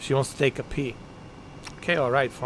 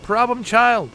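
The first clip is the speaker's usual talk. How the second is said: calling out; 8 semitones higher than usual. Real, not acted. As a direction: call her out across the distance. Calling out to companion.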